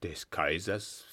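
low, slow, softened question; understated astonishment, rising at the end; astonished. A narrator's reading. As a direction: echo it slowly in quiet disbelief. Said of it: "fragte Ney erstaunt".

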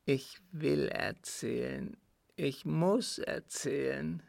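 measured, heavy-hearted dread; tormented dying father. Acted. Father telling his son of God's judgement, tormented. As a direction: refuse comfort, fearing the judgement.

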